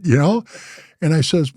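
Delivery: normal talking voice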